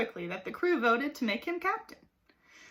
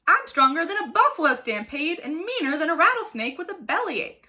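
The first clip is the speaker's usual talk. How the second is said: loud, higher snarl of a tough boaster; 6 semitones higher, 6 dB louder than usual. A narrator's reading. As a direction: snarl the brag, louder and higher. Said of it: "snarled Carpenter".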